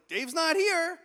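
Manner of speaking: high, loud joke reply; calling back. Retold or imitated. Nervous speaker answering the stranger from a stall, calling back. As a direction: holler back from behind a stall door.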